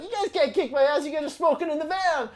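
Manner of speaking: high, loud mock-outraged comeback; replaying a cheeky protest to bandmates; indignant retort. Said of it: "I'm like".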